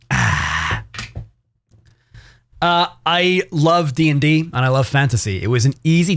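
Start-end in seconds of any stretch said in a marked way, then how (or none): 0.1-0.8 voiceless exhale after a sip; long whispery "ahh"; refreshed "ahh". Real, not acted. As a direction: breathe out a satisfied drink sigh. Sigh after taking a sip.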